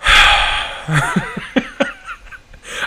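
breathy incredulous laugh-sigh, rising high; laugh-sigh in disbelief; exasperated laughing sigh. Real, not acted. Reviewer reacting to a silly actor fact, incredulous laughing sigh.

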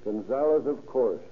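usual speaking voice

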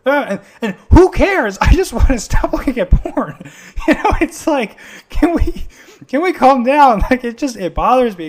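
loud, high, laughing through the frustration; can we calm down, said laughing and loud; laughing exasperation. Real, not acted. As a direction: laugh it off while venting hard. Exasperated rant about doctrine debates.

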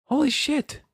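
high, startled exclamation; shocked. Real, not acted. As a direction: blurt it out in disbelief. Shocked by low review score.

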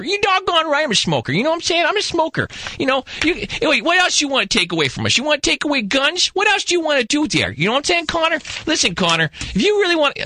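hammed-up Bruce, high and defensive; imitating defiant Bruce. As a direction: play him up as a riled-up smoker. Bruce voiced defending smoking.

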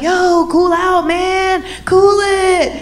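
calm him down, bro style; frat bro. Retold or imitated. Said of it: "they're like".

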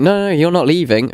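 cheerfully overturn the bad news; mock host reassurance. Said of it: "they're like".

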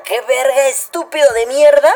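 put-on shouted high, loud drunk challenge; angry drunk insult. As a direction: barks it out like a drunk looking for a fight. Drunk guy picking a fight. (insulto de borracho gritado, agudo y fuerte)

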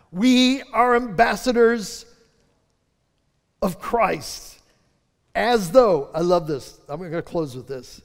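slow, weighty pulpit delivery; slow preaching. Acted. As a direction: lean on every word, slowly.

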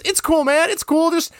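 lightly imitated creepy guy, pitched high; high-pitched, mildly creepy reassurance. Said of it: "is this creepy guy that hits".

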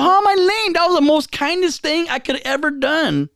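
high-pitched wounded protest; indignant disbelief. Retold or imitated. Acted protest at being called lame.

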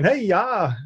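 high-pitched joke superhero impression; Batman. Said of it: "here's my Batman".

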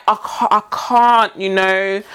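drawn-out, embarrassed refusal; slowed-down awkward "I can't"; slow flustered stammer. Retold or imitated. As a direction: stretch it out, lost for words. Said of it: "I would be like".

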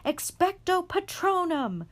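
very high, loud yell. A narrator's reading. Harry yelling the spell at the Dementors. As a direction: scream the spell against the screaming. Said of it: "Harry yelled".

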